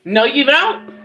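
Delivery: normal talking voice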